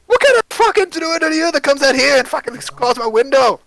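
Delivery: high, loud, shouting character voice; furious rant. Acted. Dwarf screaming at the druid over his window. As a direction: rip into her at full volume.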